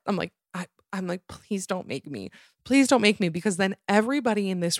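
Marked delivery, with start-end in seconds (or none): 1.3-2.3 soft, slow pleading; quiet plea. Retold or imitated. Begging the one-armed customer, quietly and slowly. 2.6-3.3 higher, louder desperate begging; loud plea. Retold or imitated. Begging the customer not to make her yell, louder and higher.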